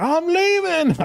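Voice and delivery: high, squeaky mock of dad storming off; dad announcing he's leaving, mocked sky-high; mock dad exit. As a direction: toss it off as the dad walking out, voice jumped way up. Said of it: "He's like".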